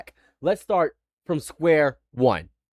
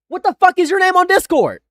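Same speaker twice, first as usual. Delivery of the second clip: high, loud, exasperated shouting; angry demand; 14 semitones higher, 8 dB louder than usual. Real, not acted. Streamer confronts caller over his Discord name, angry shout. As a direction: yell it as a furious demand.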